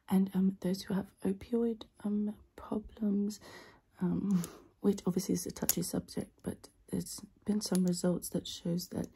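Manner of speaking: out of breath. Acted. Whispers sponsor read ASMR.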